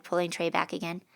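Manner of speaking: usual speaking voice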